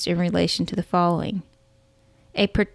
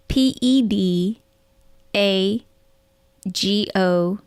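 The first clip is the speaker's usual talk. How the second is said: slow letter by letter spelling; spelling out slowly; 2 semitones lower, slower than usual. Shown as a demonstration. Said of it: "It's spelt".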